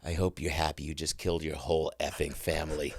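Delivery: low, soft deadpan reproach; wife's grim reproach. Retold or imitated. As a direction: quote a furious wife under your breath. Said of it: "she looks at me and goes".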